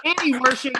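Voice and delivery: big curtain-call introduction; announcer roll call. Real, not acted. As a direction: present the performer to applause. Host's roll call of the cast.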